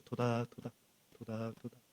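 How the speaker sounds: 토닥토닥 said very softly, fading; soft patting sound. Shown as a demonstration. Pastor showing the congregation a gentle pat, very soft. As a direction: hush it like patting a child. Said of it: "토닥토닥 해보세요".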